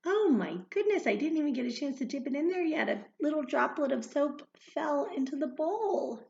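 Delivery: high, loud, rushed exclaiming; fast flustered surprise; surprised. Real, not acted. Soap drop surprise.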